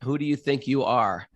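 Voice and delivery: normal talking voice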